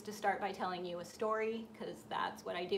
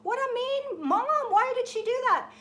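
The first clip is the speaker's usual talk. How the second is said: much higher and louder, indignant cry; outraged; 13 semitones higher, 8 dB louder than usual. Retold or imitated. Dreamer scolding the mother bird, outraged and loud. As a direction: protest at the mean mother. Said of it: "And I'm like".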